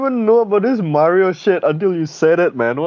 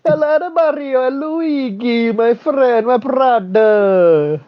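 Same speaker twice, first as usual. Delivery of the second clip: higher, slow hammed-up Italian accent; mock Italian accent; 6 semitones higher, much slower than usual. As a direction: drag out a cartoon Italian greeting. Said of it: "You expect Shinzo Abe to say that accent?".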